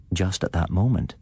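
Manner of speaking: usual speaking voice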